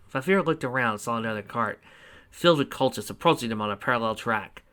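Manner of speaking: usual speaking voice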